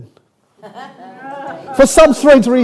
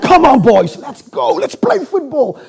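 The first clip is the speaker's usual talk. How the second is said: retold boyish call to play, higher pitched; eager rallying call; 4 semitones higher than usual. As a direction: imitate rallying the lads for a game.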